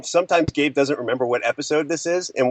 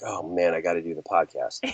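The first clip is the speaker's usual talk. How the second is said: mock weary groan; 9 semitones lower, 7 dB quieter than usual. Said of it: "rarely a time when I'm like".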